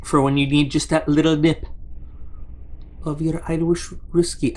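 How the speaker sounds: a jokey, hammed-up Irish brogue on the whiskey line; bad Irish accent. Unboxing a flask, joking about Irish whiskey in a bad Irish accent. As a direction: slip into a mock Irish accent for a laugh. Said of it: "that's a terrible accent".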